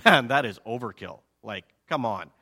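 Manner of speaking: low, grumbling disbelief; skeptical listeners. Acted. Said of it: "the listeners are probably thinking".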